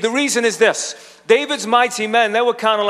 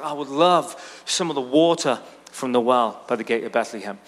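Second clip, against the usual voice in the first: wistful sigh; 6 semitones lower, 4 dB quieter than usual. Retold or imitated. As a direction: lower and softer, a longing half-whisper fading out. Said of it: "He's just kind of sighing".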